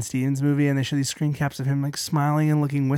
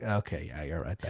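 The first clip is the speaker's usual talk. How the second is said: much lower, quieter grumbled agreement; low mock Indy voice; 12 semitones lower, 17 dB quieter than usual. Voicing Indiana Jones, low.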